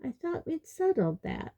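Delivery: high, airy-voiced certainty; maddeningly sure, pitched well up; maddeningly unbothered. A narrator's reading. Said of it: "said Luna maddeningly".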